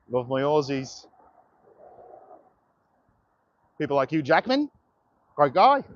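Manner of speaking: rough Australian accent, rising at the ends; shitty Australian accent. Shown as a demonstration. Streamer greeting Australian viewers, in a badly faked Australian accent. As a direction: put on a bad Aussie accent. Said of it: "That's my shitty Australian accent for you".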